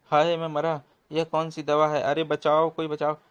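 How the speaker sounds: loud, high cries of pain; pained cries for help. Acted. Beaten thug cries out for help in loud pain. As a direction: howl for help under the blows.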